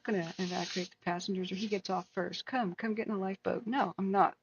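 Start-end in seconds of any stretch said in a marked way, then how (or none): none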